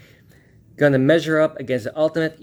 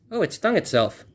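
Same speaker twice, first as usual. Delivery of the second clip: usual speaking voice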